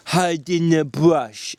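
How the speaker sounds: slow, careful repeating; Lenny slowly repeating George's instructions to remember them. A narrator's reading. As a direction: say it slowly as if memorising. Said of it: "said Lenny slowly".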